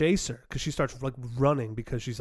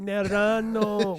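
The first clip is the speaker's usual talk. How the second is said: drawn-out Italian Nerano, slow and higher; imitating the great-aunt; 7 semitones higher, 3 dB louder, much slower than usual. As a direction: stretch Nerano out like an old Italian lady. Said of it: "she just kept calling me Nerano".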